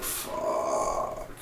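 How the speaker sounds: very low, soft, drawn-out curse; deflated curse. Retold or imitated. Reviewer re-enacting learning of a spoiler, deflated. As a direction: let it sink right down in dismay. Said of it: "basically how I felt".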